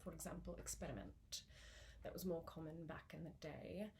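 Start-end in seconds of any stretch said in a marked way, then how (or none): 0.6-1.4 "experiment" with a tapped R; old RP "experiment", R flicked once; flipped R. Shown as a demonstration. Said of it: "the flipped R of".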